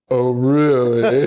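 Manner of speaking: slow, smug and laughing "Oh, really?"; smug smile. Retold or imitated. The Jin grinning at Kane Hodder, slow smug tease. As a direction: draw it out with a nasty grin. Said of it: "has the most disgusting smile".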